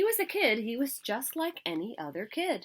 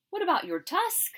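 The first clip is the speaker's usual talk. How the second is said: bright idea; 11 semitones higher, 4 dB louder than usual. A narrator's reading.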